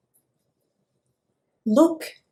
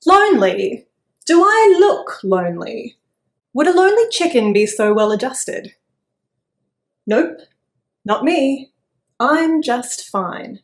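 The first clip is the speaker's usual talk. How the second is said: loud indignant denial, rushing then slowing; defensive chicken; 4 semitones higher, 9 dB louder than usual. Acted. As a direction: protest far too much. Chicken denies being lonely.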